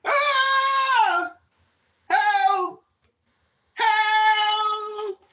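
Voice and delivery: piercing high cries for help; screaming for help. Acted. Dreamer crushed by the stone, screaming for help. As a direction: scream in terror. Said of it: "the sleeper".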